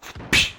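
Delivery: short, sharp "psh" impact noise; quick noisy burst imitating a smack; imitated splat. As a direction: mouth the splat of something hitting a wall. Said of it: "all you would hear is a big".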